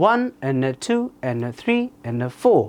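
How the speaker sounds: running rhythmic count, "and, uh" low and weak, numbers lifted; sentence stress. Shown as a demonstration. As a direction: bounce from low "and, uh" up to "one", "two", "three", "four". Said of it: "এটাই হচ্ছে sentence stress". (তালে তালে, সংখ্যায় জোর, "and, uh" নিচু)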